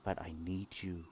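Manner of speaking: very soft, low and soothing; hushed low voice, gently steadying someone in distress; soft calming voice. Acted. Game master as mysterious voice steadying a daughter, soft and calming. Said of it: "hear a soft voice".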